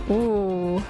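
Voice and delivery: ordinary talk, nothing marked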